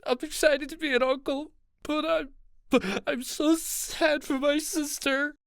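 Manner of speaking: very high, blubbering stammer; breaking down crying. A narrator's reading. Ez blubbers about becoming an uncle, tearful and high. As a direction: wail it out, stumbling on the words. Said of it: "Ez finally broke".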